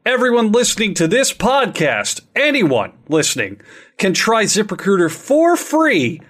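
slow, spelled-out ad read; slow it down for the address; slow emphatic read. Shown as a demonstration. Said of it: "As of right now".

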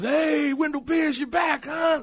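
high, shouted imitated greeting; delighted sheriff. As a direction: holler it like a sheriff greeting a friend. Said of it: "he would come in and he goes".

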